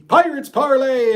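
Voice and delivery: loud high show-title shout; show name callout. Real, not acted. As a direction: call out Pirates Parlay loudly, high. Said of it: "say it with me".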